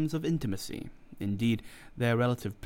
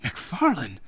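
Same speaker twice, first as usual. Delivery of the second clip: loud shocked cry of the name; cried out; 5 dB louder than usual. A narrator's reading. Fettes to McFarlane, crying out in shock at the murders. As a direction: call his name out in alarm. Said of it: "cried Fettes".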